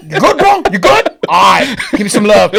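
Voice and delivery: high, loud, laughing bro voice; hammed-up hug request; mock bro greeting. Host imitating himself hugging Victor, high laughing. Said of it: "I was, like, just sniffing him".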